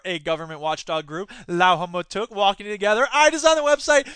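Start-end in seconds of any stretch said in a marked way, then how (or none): 3.1-4.1 higher, louder proud claim; a lightly voiced smug hippie; mock hippie brag. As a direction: brag about it smugly. Hippie bragging.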